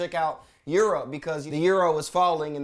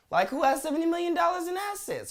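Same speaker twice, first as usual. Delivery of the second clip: incredulous; 13 semitones higher than usual. Real, not acted. Incredulous about seventy million bail.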